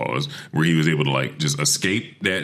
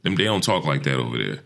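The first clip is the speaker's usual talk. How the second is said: ordinary delivery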